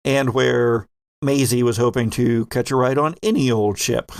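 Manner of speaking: usual speaking voice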